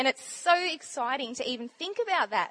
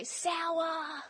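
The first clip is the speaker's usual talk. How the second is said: softer, long-held mock child's call; kids calling out; 2 semitones higher, 6 dB quieter than usual. Kids on the bus when you don't care, softly drawn-out call. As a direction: draw it out without pushing. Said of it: "they go".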